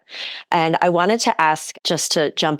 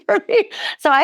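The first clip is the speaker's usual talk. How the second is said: laughing; 3 dB louder, faster than usual. Retold or imitated. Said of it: "I'm like".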